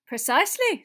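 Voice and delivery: loud, high professor voice; emphatic schoolmistress agreement; Professor McGonagall. A narrator's reading. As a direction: confirm crisply as a woman teacher. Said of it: "said Professor McGonagall".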